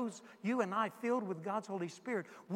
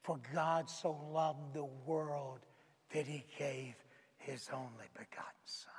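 fading to a whisper; 5 semitones lower, 5 dB quieter than usual. Acted. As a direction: let it sink to a hushed secret. Jesus speaks intimately to Nicodemus.

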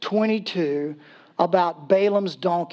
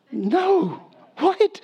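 high-pitched disbelieving double take; astonished, voice leaping up on the question; incredulous; 10 semitones higher than usual. Acted. Preacher reacting to a donkey that talked, incredulous.

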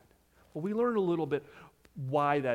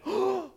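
mock gasp. Mock shock at the scandal.

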